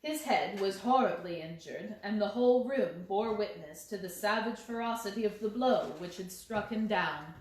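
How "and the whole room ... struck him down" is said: plain reading voice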